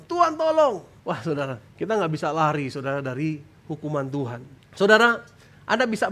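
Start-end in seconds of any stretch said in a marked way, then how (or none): 0.1-0.9 very high acted shout to God; imitated cry for help. Bullied believer calls on God, high acted shout. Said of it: "dan dia teriak pada Tuhan".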